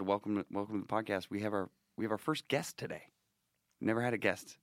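normal talking voice

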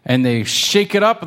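usual speaking voice

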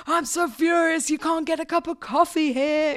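hammed-up furious complaint; fake anger. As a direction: ham up a fake outrage. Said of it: "conjure up fake anger".

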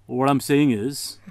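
mock Indian uncle holding forth; Indian uncle accent. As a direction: drag it out like a lecturing uncle. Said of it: "an Indian uncle will say stuff like".

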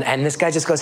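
ordinary talk

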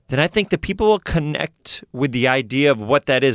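usual speaking voice

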